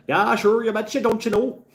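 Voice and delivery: "sure" stretched, go big and sing-song like a caricature; hammed-up Minnesota accent. Riffing for chat, a hammed-up high Minnesota accent. Catchphrase again with "don't you know.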